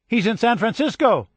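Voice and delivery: high, loud call to the room; excited yell. A narrator's reading. Girl yelling the news to the family. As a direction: shout the news over your shoulder. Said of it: "she yelled to the others".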